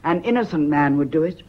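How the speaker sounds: loud, cold demand; loud challenge. Acted. Wife dares husband to face the inspector, loud and cold. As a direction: dare him to prove it.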